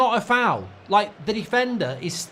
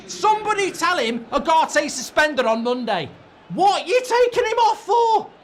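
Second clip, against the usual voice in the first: furious shouting; 10 semitones higher than usual. Real, not acted. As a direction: yell at the TV in real outrage. Furious at substitution, yelling at screen.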